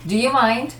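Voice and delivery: mock-indignant challenge to the interrupter. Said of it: "maybe Fernanda would interrupt him".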